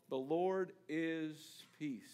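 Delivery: very slow "The Lord is Peace"; slow and weighty. Shown as a demonstration. Preacher naming the altar, slow and weighty. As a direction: let each word land slowly.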